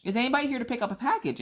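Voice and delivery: postal clerk calling out. Retold or imitated. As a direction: call it out fast over the queue. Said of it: "and she's like".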